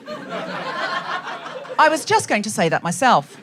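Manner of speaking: slow, drawn-out assistant voice with a hint of flirtation; a touch of Alexa, stretched out slowly; lightly voiced Alexa. Alexa cosying up to the husband, lightly voiced assistant. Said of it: "she says things like".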